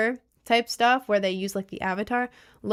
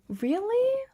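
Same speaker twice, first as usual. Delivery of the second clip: high-pitched, drawn-out gushing; touched delight; 12 semitones higher, much slower than usual. Real, not acted. Streamer to viewers who watch cuddled in bed, touched delight. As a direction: gush as if melted by a sweet compliment.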